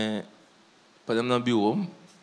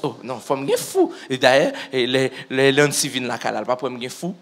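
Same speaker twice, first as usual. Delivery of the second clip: ordinary talk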